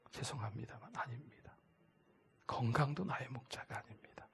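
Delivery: apologetic whisper; soft whispered correction; whispered denial. Acted. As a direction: lean in and whisper it gently. Soft aside in sermon.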